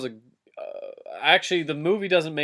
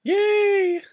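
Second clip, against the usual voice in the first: excited cheer; 8 dB louder than usual. Real, not acted. Cheering Legolas rescue.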